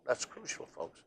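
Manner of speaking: ordinary talk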